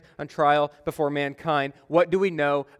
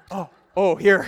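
flustered; 3 semitones higher, 5 dB louder than usual. Retold or imitated. Caught out, handing back.

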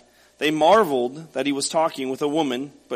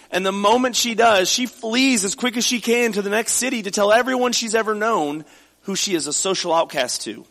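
preaching; 4 semitones higher than usual. Acted. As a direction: preach it with fervour to the congregation. Pastor building to full preaching.

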